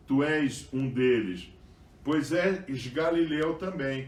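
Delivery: scripture reading. Acted. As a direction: read the passage out to the congregation. Reads the scripture passage aloud. (leitura bíblica em voz alta e elevada)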